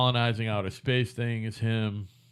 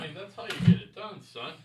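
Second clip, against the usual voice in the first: high, teasing echo of the caller; higher mocking echo; 7 semitones higher, 7 dB quieter than usual. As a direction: throw the line back at him, mocking. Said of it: "echoes the caller".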